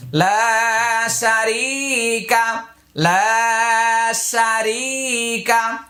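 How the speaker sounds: লা শারিকা with long drawn vowels, very slow; very slow recitation. Shown as a demonstration. Teacher modelling the phrase slowly for students. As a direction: stretch every vowel in a slow chant. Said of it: "teacher models slow recitation".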